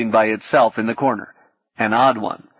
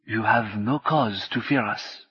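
calm reassurance; 5 dB quieter than usual. A narrator's reading. Martine calms the hacker.

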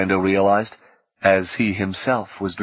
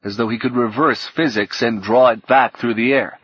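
plain reading voice

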